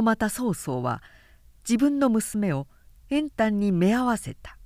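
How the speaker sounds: ordinary delivery, nothing marked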